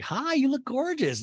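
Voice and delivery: high, gushing compliment; imitating a cast member flattering her friend; mock Sheree. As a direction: gush it up high. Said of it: "And Shree's like".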